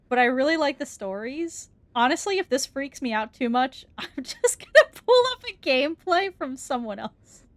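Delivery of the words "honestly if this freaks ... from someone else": normal talking voice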